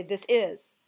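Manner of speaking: "This is" with the weight thrown on IS; this IS, stressed on the second word; wrong stress. Shown as a demonstration. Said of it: "We don't say".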